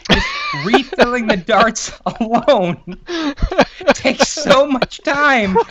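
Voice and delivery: giggling through the words; high, laughing and slow. Real, not acted. Laughing while telling bee story.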